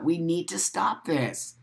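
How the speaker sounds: ordinary delivery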